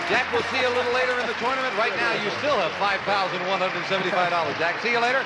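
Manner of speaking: upbeat host patter; game show host. Acted. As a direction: wrap up and throw to break. Host throws to break.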